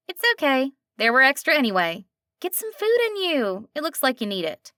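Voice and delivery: a friendly diner waitress with a slight twang; a hint of a nasal twang. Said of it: "slight twang to it".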